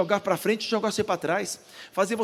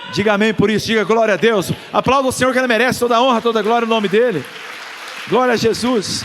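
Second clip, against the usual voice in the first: loud, rousing call for praise from the pulpit; preaching at full force; 4 semitones higher, 9 dB louder than usual. Real, not acted. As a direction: shout it out to rally the church to applaud. Preacher calls congregation to praise.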